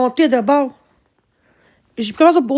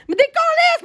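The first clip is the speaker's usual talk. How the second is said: high angry curse; 12 semitones higher than usual. Real, not acted. Streamer keeps cursing at viewers.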